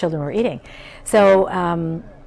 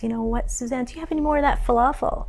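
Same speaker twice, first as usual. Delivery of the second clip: lightly voiced eager kids, higher; eager classmates; 7 semitones higher, faster than usual. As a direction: beg like excited schoolkids asking for more. Classmates begging for food.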